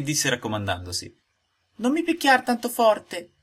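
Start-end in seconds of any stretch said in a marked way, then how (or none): 1.8-3.3 very high, slow tiny pleading voice; a tiny wooden voice begging not to be hit; thin little voice. Acted. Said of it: "una vocina sottile, sottile". (vocina sottile sottile, acutissima e lenta)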